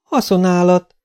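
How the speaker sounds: magasabb hangú, odavetett közlés; foghegyről odavetve. A narrator's reading. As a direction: vesd oda kurtán. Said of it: "vetette oda a két sintérnek". (félvállról odavetve)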